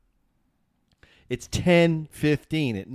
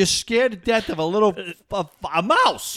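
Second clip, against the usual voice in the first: higher, emphatic mock dressing-down; retold exasperated lecture; incredulous scolding; 7 semitones higher than usual. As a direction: hammer each point in disbelief. Retold scolding of panicked friend.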